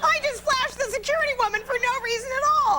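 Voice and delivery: high, squealed punchline; mock outcry. Comedian recalling her airport search to the host, hammed-up outcry. Punchline of embarrassed security story.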